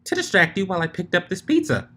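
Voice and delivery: Pinky voice. Acted. Pinky character voice.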